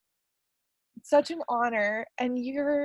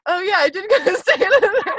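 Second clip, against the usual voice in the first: laughing reply; 8 semitones higher, 7 dB louder than usual. Retold or imitated. Telling Grandma about the Santa Fe trip, laughing through it. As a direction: answer through laughter, a bit higher and louder.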